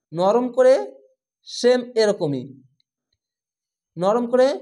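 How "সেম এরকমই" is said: usual speaking voice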